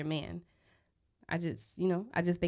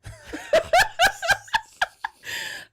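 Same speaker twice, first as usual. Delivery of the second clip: loud squealing laughter; nervous laughing burst; high laugh; 7 dB louder than usual. Real, not acted. Flustered after bringing up past.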